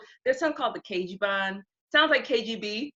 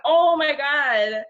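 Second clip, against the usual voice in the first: drawn-out, higher and louder mock shock; retold shock; 6 semitones higher, 6 dB louder, much slower than usual. Telling friend about lewd Skype messages, mock shocked. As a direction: stretch it out loud, scandalized for effect.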